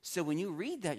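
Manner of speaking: normal talking voice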